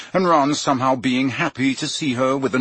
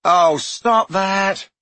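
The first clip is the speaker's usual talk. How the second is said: very slow, high, louder plea; begging; 9 semitones higher, 4 dB louder, much slower than usual. Acted. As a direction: drag it out like a groan. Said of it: "Ron begged her".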